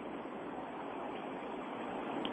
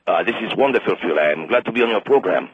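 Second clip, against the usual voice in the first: low, softer phony French voice; fake French accent; 5 dB quieter than usual. French guest thanking the radio host, fake French accent. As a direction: put on a deep, bad French accent. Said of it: "our French friend".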